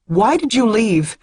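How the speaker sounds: high, loud demand; loud, high demand while shaking him; pleading demand. Acted. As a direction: shake the answer out of him. Shaking him hard by collar.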